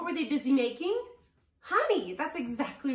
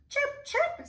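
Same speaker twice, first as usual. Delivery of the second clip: very high little chirps; two short, high-pitched peeps; bird chirp; 3 dB louder than usual. A narrator's reading. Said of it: "says the bird".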